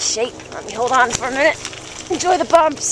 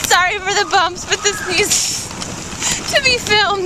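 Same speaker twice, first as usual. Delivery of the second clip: on a bumpy road; 6 semitones higher than usual. Real, not acted. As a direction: voice jolted by bumps. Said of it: "makes you wanna throw up".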